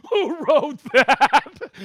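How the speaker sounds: laughing out the words, slow and loud; laughing hard. Real, not acted. Host laughing at a viewer's joke location, laughing out the words. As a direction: crack up while asking.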